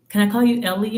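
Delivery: ordinary talk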